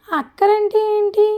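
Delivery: high, slow puzzled question. A narrator's reading. Husband asks his shy bride what the word means, puzzled and slow. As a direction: ask as if you don't get it at all. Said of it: "అర్థం కానట్టు అడిగాడు".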